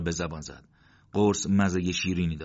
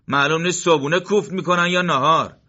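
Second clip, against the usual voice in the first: raised, louder scornful jab; mocking soldier; 10 semitones higher, 8 dB louder than usual. Morteza mocks the eating enemy, raised scornful voice. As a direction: sneer at the enemy's meal.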